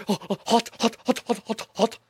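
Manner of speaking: high, yelping mock panic over a splash; imitating a panicked tourist. Mocking the tourist splashed by the geyser, high panicky yelps. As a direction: squeal "hot, hot" like scalded. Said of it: "freaked out and was just like".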